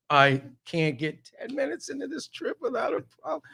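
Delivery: high, exasperated whine at being stuck; exasperated wail. Retold or imitated. Stuck in traffic, late for the airport: high exasperated wail. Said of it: "Now I'm screaming".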